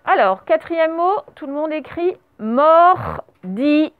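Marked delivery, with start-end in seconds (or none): 2.4-3.9 MORDILLER stretched slowly over separate syllables; syllable-by-syllable dictation. Shown as a demonstration. Teacher dictating the fourth word to pupils, syllable by syllable.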